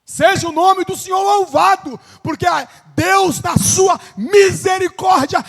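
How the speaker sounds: loud, high preaching shout; shouted praise. Acted. Preacher praising God's mercy to the church, in a loud high shout.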